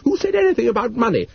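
alarmed. A narrator's reading. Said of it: "alarmed at this fresh evidence".

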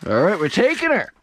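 thrilled shouting; excited, loud and high. Real, not acted. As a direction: shout with joy at the catch. Celebrating keeping the fish.

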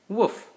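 a single high, loud woof; puppy bark. Acted. Dad reading a bedtime book, barking as Biscuit. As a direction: yap once like a happy puppy.

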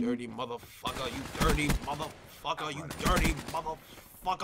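usual speaking voice

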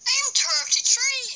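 put-on high squeaky Irish accent; hammed-up high Irish accent. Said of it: "That's my Irish accent".

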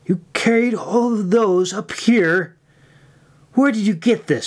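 high, incredulous demand. A narrator's reading. Irene questions May about the charms, high-pitched demand. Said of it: "she demanded".

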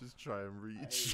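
"Just try and reach" slowly and evenly; slowly repeating the line as a joke; slow echo. Retold or imitated.

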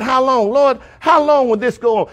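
high, pleading outcry; crying out. Acted. Suffering souls asking the Lord how long, crying out. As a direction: call out to God in a high, insistent voice. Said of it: "they cried".

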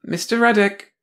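high prim bureaucrat voice; a prissy officious clerk; prim officious woman. Acted. Blonde scolds.

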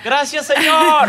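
exclamación aliviada, más aguda y lenta; suéltalo con alivio, despacio; gracias aliviado. Retold or imitated. Agradecido tras casi ser atropellado. (agradecimiento aliviado)

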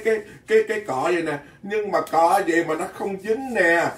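usual speaking voice

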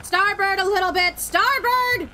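urgent shouted orders; shouting. Real, not acted. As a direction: call it out loud across the deck. Steering the ship, calling out.

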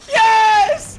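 high joyful shout; excited shout. Real, not acted. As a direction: cheer at the lucky find. Lucky vending machine find.

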